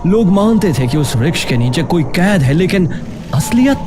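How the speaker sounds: usual speaking voice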